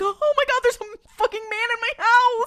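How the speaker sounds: high, loud mock freak-out; hammed-up alarmed yell; panicked roommate. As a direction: shriek as if you found an intruder. Roommate finds intruder in house.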